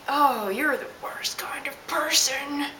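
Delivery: slow, whispered disgust; whispered aside. Retold or imitated. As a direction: breathe it out in disbelief. Muttered reaction to the guy.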